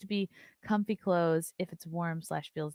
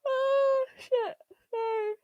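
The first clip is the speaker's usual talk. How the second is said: high squeaky yawn; 5 dB louder, much slower than usual. Real, not acted. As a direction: let a yawn break the words. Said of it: "Sorry to yawn".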